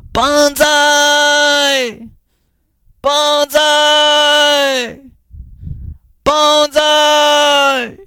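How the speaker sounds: very high, loud, long held cries of Banzai; yelling banzai. Retold or imitated. Thousands of climbers greeting the sunrise, long loud cheers. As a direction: call it out to the sunrise, drawn out.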